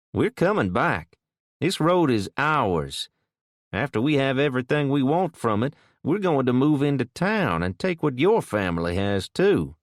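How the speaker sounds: put-on threatening kid. A narrator's reading.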